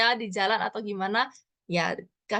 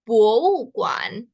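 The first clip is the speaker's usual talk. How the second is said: slow demo; 5 dB louder, much slower than usual. Shown as a demonstration. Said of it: "gimana cara bacanya?".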